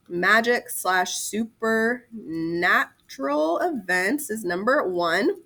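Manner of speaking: slow, spaced dictation; measured dictation pace. Shown as a demonstration. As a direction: read it out slowly for note-taking. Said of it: "dictating bullet".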